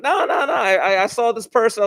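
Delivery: high-pitched Brooklyn dad imitation; major Brooklyn accent. Dad waving off his son's objection, Brooklyn accent. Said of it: "a major Brooklyn accent".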